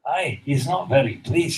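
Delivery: ordinary talk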